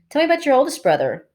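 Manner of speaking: put-on girl's voice. A narrator's reading. Cherry's eager request.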